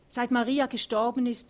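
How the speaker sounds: „Maria“ laut und höher, mit aufgewühlter Stimme; bewegt ansetzend. Acted. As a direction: setzt aufgewühlt neu an, „Maria“ betont. Thea beginnt erneut, bewegt. (Thea setzt laut und erregt zu „Seit Maria gestorben ist“ an)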